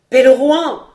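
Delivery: loud, high-pitched call; cry for help. A narrator's reading. Wounded squire calls a passing charcoal burner, crying out. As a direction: call out to a distant man for help. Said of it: "cria-t-il".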